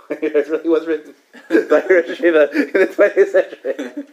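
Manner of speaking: high, loud words breaking into laughter; slow down as real laughter takes over; laughing while talking. Real, not acted. Teacher insisting he didn't make this up, laughing.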